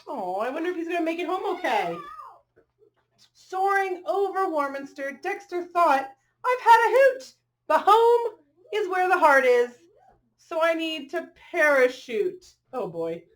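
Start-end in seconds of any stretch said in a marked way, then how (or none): 3.3-12.5 bright, rhythmic picture-book read-aloud; reading to children. A narrator's reading. Reading a rhyming dog story to children, lilting storyteller voice. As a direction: read the rhyme to the little ones.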